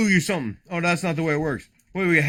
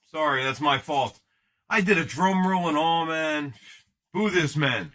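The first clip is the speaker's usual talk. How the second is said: ordinary talk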